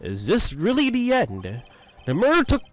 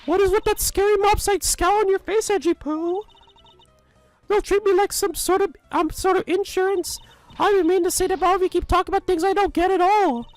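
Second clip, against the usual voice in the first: much higher, fussy granny voice fawning over Edgy Poo; doting old lady. Acted. Old lady fawning over Edgeworth with her gift, high doting granny voice. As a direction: gush like a lovestruck old busybody.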